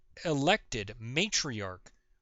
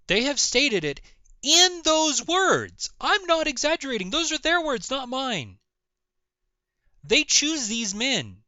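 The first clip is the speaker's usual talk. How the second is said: higher and louder, insisting; exasperated; 9 semitones higher, 6 dB louder than usual. Real, not acted. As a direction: press the point, fed up.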